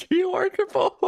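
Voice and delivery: high, laughing through it; breaking up mid-sentence. Real, not acted. Laughing while talking.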